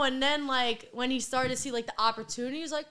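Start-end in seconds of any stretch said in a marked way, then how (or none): none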